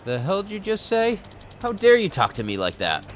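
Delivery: indignant snap; angry retort. Acted. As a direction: bite back at the insult. Acted quarrel with the thug.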